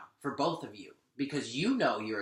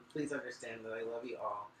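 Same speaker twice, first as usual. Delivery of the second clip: reassuring; 2 semitones lower, 8 dB quieter, faster than usual. Real, not acted. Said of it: "soothing after outburst".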